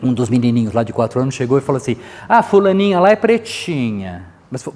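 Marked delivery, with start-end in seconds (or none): 2.3-4.3 put-on slow, high, loud childish taunt; drawn-out disparaging singsong, pitched up; pejorative child. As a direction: imitate a little kid's put-down. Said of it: "falou com essa entonação meio assim pejorativa".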